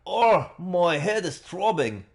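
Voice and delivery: put-on groggy young hero clutching his head; Ryunosuke voice. Player voices Ryunosuke waking groggy, pained. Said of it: "voice I use for Ryunosuke".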